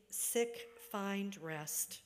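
ordinary delivery

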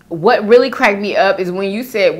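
ordinary talk

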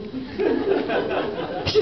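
breathless giggling aside; laughing through it. Real, not acted. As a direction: crack up while saying it. Comic cracks up mid joke.

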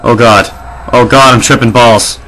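ordinary delivery

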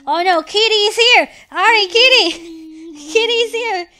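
playful panic at full volume; alarmed warning. Real, not acted. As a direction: yell that danger is coming. Warns that the monster is coming.

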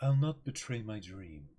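slow, cool and detached; calm Griffith. Acted. Cohost voicing Griffith's cold reply to Guts. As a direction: say it like nothing can move you.

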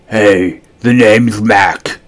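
slobbery monster voice. A narrator's reading. Mac introduces himself to the boy, slow and slobbery. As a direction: drawl it like a scruffy slob.

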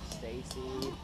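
ordinary delivery

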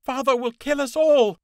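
terrified scream. Acted. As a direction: scream it in terror as they're spotted. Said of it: "Holly screamed".

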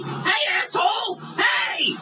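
very high, drawn-out yell; furious shout. Acted. Customer yelling after the departing driver, furious. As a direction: scream after someone getting away.

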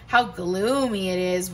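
ordinary delivery, nothing marked

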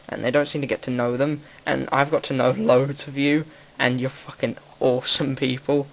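ordinary talk, nothing marked

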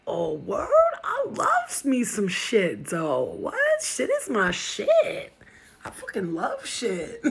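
loud, swooping, hammed-up delight; brash comic bug butting in; nosy fly. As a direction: stretch the words out with big, excited pitch jumps. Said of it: "He's like".

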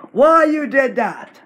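angry demand. Real, not acted. Kid yelling at the copycat channel, angry. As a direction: shout it at the copycat.

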